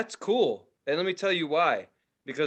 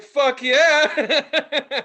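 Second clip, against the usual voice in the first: excited cheer; 9 semitones higher, 8 dB louder than usual. Pretend. Imagined cheering at club.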